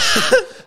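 high, loud laugh at himself; real laughter bursting out. Real, not acted. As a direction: crack up right after the yell. Laughs after his own yell.

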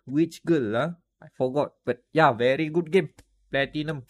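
put-on choppy local street-vendor accent; put-on seller's accent. Seller recommending a game to a customer, put-on vendor accent. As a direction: hawk it in a bootleg-seller accent. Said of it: "I'm doing that accent".

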